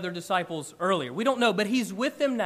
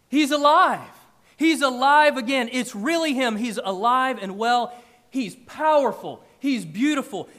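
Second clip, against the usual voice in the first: ecstatic; 3 semitones higher, 6 dB louder than usual. Acted. Preacher voicing the disciples' joy.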